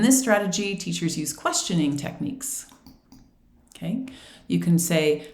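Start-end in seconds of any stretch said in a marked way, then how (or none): none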